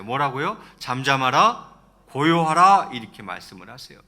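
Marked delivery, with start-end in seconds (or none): 0.8-2.9 loud acted order to the sea; rebuking command. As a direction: command the waves, firmly and loudly. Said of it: "바다를 향해서는 이렇게 말씀하세요". (바다를 꾸짖듯 크게 명령하며)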